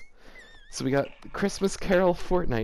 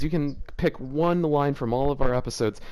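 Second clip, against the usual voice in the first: normal talking voice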